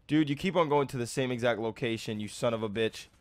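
low, quiet, fast grumble; seething annoyance. Real, not acted. Player pissed off at the same exact location, seething annoyance. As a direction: keep the anger low and soft. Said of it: "This is pissing me off".

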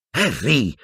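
a sharp protesting call. A narrator's reading. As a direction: cut him off in alarm. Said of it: "protestò Hermione".

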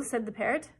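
ordinary delivery, nothing marked

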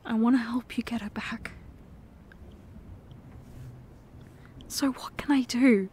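soft pleading whisper; whispered plea. Acted. Whispering to his girlfriend asking how to help. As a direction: quietly offer help. Comforting partner after drinking confession.

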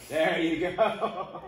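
loud, drawn-out praise with a laugh; delighted cheer. Real, not acted. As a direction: cheer them on, stretching it out. Cheering the child's downward dog.

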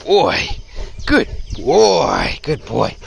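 playful praise to a dog mid-tug; hearty dog-handler encouragement; encouraging praise. Real, not acted. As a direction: keep the dog going with praise.

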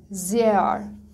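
زیر as "zeh-a" with stretched e, no h; long vowel, silent h. Shown as a demonstration. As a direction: model زیر with the vowel held.

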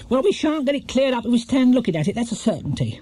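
high, brisk no-nonsense voice. A narrator's reading. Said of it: "said Missus Bird briskly".

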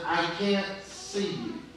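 ordinary talk

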